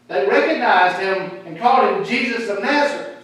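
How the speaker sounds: ordinary delivery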